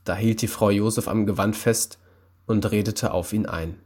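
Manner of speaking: plain reading voice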